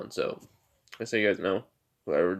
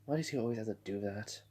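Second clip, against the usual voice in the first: soft, quick muttered complaint; grumbling under breath; 11 dB quieter, faster than usual. Retold or imitated. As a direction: grumble it to yourself, barely voiced. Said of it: "grumbling underneath her breath".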